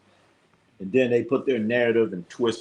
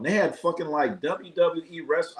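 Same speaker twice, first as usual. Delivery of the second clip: usual speaking voice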